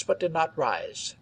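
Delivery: ordinary delivery, nothing marked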